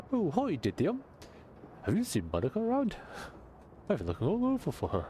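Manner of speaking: put-on high, eager street-kid accent; bright street-kid voice, a bit fast; kid's accent. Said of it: "That's the accent this kid has".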